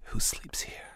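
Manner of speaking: a hushed, menacing question from the boss; boss lowering his voice. Retold or imitated. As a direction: voice dropped, almost a whisper. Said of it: "he lowered his voice really low".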